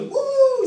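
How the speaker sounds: sudden loud high "uh" shout; high excited whoop. Retold or imitated. Imitating the churchgoers jumping, a high loud whoop. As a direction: yelp like a jumping worshipper.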